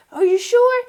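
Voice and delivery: put-on high, loud young boy asking; kid voice. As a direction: squeak it like a hopeful kid. Kid Cash voiced in retelling.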